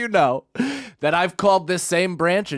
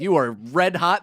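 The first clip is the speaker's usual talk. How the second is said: usual speaking voice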